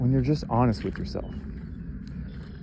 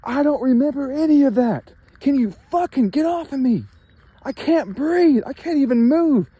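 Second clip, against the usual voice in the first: much higher, louder, choking pleas; mock-gasp that you can't breathe, pitched way up; imitating a desperate victim; 13 semitones higher, 4 dB louder than usual. A pinned-down victim begs his attackers, high and desperate. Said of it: "You're like".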